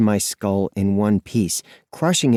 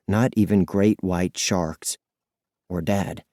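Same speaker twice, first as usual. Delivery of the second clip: ordinary delivery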